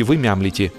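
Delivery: plain reading voice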